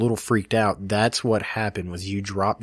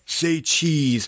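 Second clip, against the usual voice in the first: mock out of breath; 5 semitones higher than usual. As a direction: imitate a winded photographer, higher voice. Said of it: "I was so out of breath".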